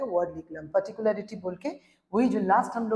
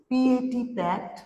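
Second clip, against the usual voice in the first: পিএটি spelt slowly, then pat said clearly; slow spelling; 2 semitones higher, much slower than usual. Shown as a demonstration. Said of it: "पैट का मतलब है". (ধীরে বানান করে বলা)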